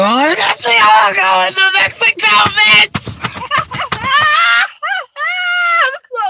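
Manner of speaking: yelling it high through laughter; laughing so hard it turns to a shout; shrieking laughter. Real, not acted. Joking to viewers about going to Mexico, then a high shrieking laughing apology.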